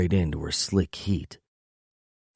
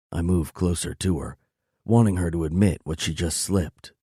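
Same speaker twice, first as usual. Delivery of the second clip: usual speaking voice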